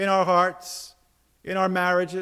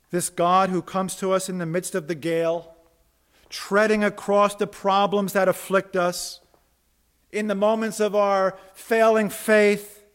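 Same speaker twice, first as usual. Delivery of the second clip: ordinary delivery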